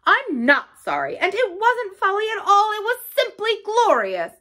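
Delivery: loud, high-pitched defiance; stoutly defiant. A narrator's reading. Toad defying Badger before his friends, stoutly unrepentant. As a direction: build from stubbornness to high, loud glorying. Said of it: "a little sullenly but stoutly".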